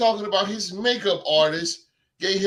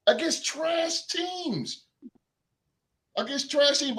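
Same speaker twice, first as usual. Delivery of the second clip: high, slow, drawn-out trash teams; disgusted podcast jab; scornful emphasis; 7 semitones higher, slower than usual. Real, not acted. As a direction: sneer at how weak they were. Mocking the schedule.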